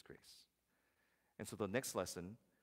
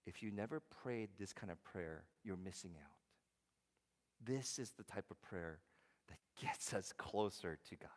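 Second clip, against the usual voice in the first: normal talking voice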